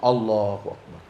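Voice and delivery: low, soft, mumbled Allahu Akbar; odd takbir. Shown as a demonstration. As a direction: do the man's strange takbir. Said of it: "ada orang takbir begini". (takbir aneh meniru orang)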